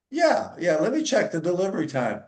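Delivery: eager receptionist. Retold or imitated. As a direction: play the chirpy young lady taking the call.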